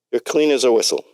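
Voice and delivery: put-on light New York Bronx accent. A narrator's reading. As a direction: say it like a Bronx doctor. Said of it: "in his Bronx, uh, New York Bronx accent".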